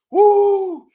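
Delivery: high loud whoop of pride; proud woo. Real, not acted. Hyped host whooping about his pride.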